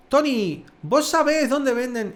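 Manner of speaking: put-on high, loud Argentine-accented question; emphatic Argentine "vos sabés". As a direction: imitate an Argentine friend calling out. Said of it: "un amigo argentino y me dice".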